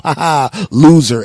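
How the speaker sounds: loud gloating laugh, punching "loser"; mocking jeer. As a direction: jeer at the driver who hit it. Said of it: "so I can go".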